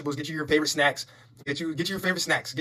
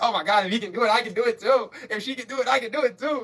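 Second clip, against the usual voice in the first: higher, eager voice of someone newly motivated; imitating inspired viewers; 6 semitones higher than usual. Fans inspired by you, in an eager higher voice. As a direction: play a fan who just realized they can do it too. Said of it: "They're like".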